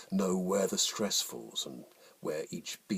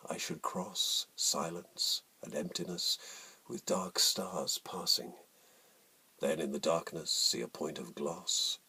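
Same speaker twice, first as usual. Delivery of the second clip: slow, hushed sonnet reading, fading to a whisper; poem recitation. Acted. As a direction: let each image hang in the silence.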